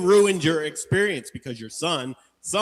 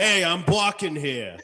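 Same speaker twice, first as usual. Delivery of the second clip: loud Jersey tough guy; New Jersey accent; 5 semitones higher, 5 dB louder than usual. Pretend. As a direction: bark it like a Jersey dog. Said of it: "do the dog in a New Jersey accent".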